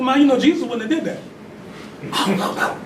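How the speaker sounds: slow, high-pitched mock reproach; sarcastic. Son needling his mother about her conduct, sarcastic. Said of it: "my time to be sarcastic".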